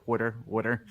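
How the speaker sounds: "water, water" parroted as "wooder, wooder"; teasing "wooder". Retold or imitated. Bartenders making fun of his accent, parroted "wooder" for water. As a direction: parrot water twice with the oo of wood. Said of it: "will make fun of me".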